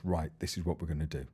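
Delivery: low, quick, quiet plan; hushed scheming. Retold or imitated. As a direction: lay out the escape plan in a fast hush. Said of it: "And he goes".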